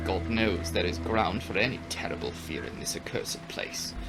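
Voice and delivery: ordinary delivery, nothing marked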